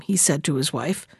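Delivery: ordinary delivery, nothing marked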